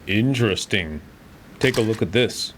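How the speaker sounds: intrigued doctor. Acted. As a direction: call it out like a curious doctor. Game character voice line.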